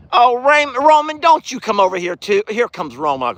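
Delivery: ordinary delivery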